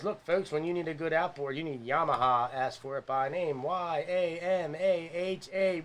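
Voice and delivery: put-on old-time radio ad pitch; chipper 1950s ad. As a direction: sell it like a vintage commercial. Mock sponsor pitch.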